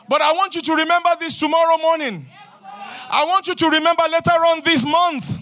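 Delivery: rousing, declaiming sermon voice; preaching at full force. Acted. Preacher proclaiming blessings to the congregation, full-force preaching.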